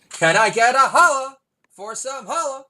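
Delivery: high, loud hype-man call; hype shout. Acted. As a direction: whip up the crowd. Hype chant ending a quote.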